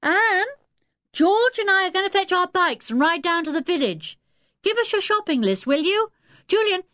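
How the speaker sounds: put-on high, lively boy's call; pitched right up to carry. A narrator's reading. Dick calls to Anne before cycling off, calling out. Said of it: "Dick called to Anne".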